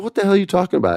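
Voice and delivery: high-pitched mock bafflement; incredulous. Seatmate hears of the ghostwritten episode, incredulous. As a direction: exclaim it as a stunned listener would.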